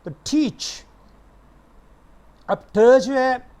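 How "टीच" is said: "टीच" high, with a puff on the t; aspirated t. Shown as a demonstration. Teacher modelling teach for students, t of "टीच" aspirated. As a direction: begin "टीच" on a breathed ठ. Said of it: "यहाँ पे ये aspirated है".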